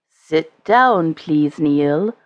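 lightly voiced low Father Christmas; a hint of deep, kindly strength; gentle deep voice. A narrator's reading. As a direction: ask gently, pitch dropped. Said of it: "gentle but deep, strong voice".

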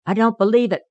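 indignant denial, pitched high; angry. A narrator's reading. Peter rejects Jenny's tale, indignantly angry. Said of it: "declared Peter angrily".

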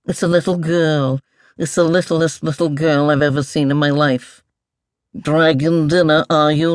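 lightly voiced fussy mole, marvelling; marvel, then draw out the teasing questions; a hint of a musing old creature; curious mumbling mole. Mole voice in story narration.

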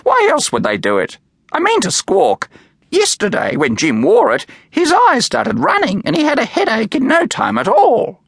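high, loud squawking bird voice; squawky parrot. A narrator's reading. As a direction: chatter like an indignant macaw. Doris the parrot speaks.

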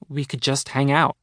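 ordinary delivery, nothing marked

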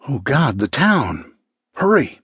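mildly frantic, pitch jumping on the last word; a touch of panic, urging him on. A narrator's reading. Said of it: "Her voice was frantic".

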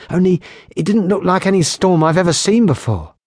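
put-on nervous young patrolman. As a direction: plead for a word, shaken.

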